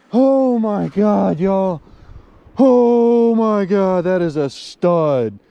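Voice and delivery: loud, high, long-drawn amazement; hyped up. Real, not acted. Angler marvelling at the stud he caught, hyped. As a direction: stretch it out slowly in a thrilled holler. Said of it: "I'm sorry I'm so hype".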